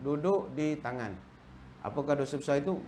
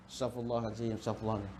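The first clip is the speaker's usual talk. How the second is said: soft pious murmur; 6 semitones lower, 7 dB quieter, faster than usual. Retold or imitated. Pious recovering after looking.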